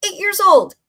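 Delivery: high, loud burst of amazement; amazed exclamation. Real, not acted. Aunt marvelling at her young niece's boldness, amazed exclamation.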